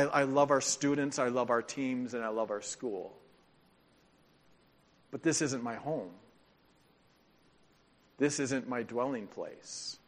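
ordinary talk, nothing marked

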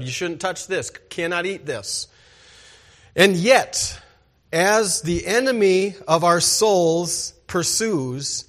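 ordinary talk

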